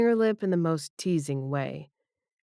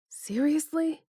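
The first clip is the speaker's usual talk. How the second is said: winded; 11 semitones higher than usual. Acted. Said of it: "I say, winded".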